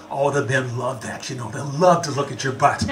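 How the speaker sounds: a hint of a low, sly girlfriend voice; lightly voiced roommate. Roommate teasing about the tight skirt, lightly voiced low and sly. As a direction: tease him, low and knowing. Voicing Renata.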